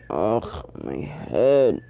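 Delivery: low, woozy grumble; dizzy groan. Acted. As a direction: groan it low, head spinning. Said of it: "he was dizzy".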